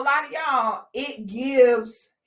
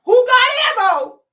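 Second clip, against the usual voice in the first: higher, louder cry for ammo; mock panicked shout; 10 semitones higher, 7 dB louder than usual. Narrator yelling for ammo in mock panic at the chaos. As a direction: shout it out in hammed-up alarm.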